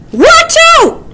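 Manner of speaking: high, loud warbled warning; wagtail warbling. A narrator's reading. Wagtail warbling a warning to the friends. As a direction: sing out the warning like a bird. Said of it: "warbled a wagtail".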